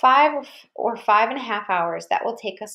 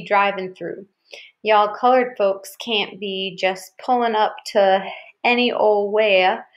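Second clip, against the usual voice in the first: slow Southern hillbilly drawl; Dad hamming up a slow hillbilly Southern accent; slower than usual. Retold or imitated. Dad teasing the kids in a mock hillbilly Southern accent. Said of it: "Dad did an imitation of a hillbilly accent".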